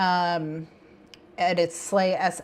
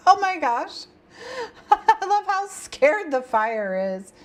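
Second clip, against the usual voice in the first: high, giggly gushing; laughing delight; 12 semitones higher, 3 dB louder than usual. Real, not acted. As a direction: squeal it through laughter. Laughing at the scared fire.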